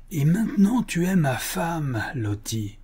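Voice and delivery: high, drawn-out gloating call; demonic triumphant cry. A narrator's reading. Hankins carrying off Lottie in the carriage, triumphant cry. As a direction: crow it slowly, without laughing. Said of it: "criait Hankins avec le rire rauque d'un démon".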